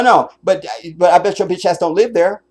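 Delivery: ordinary talk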